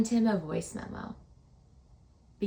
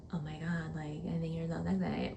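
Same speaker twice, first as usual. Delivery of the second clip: soft, hushed attempt at sexy; trying to sound seductive and failing; mock sexy memo; 2 semitones lower, 8 dB quieter than usual. Awkward woman practising a sexy voice memo for Kevin. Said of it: "voice memo being like".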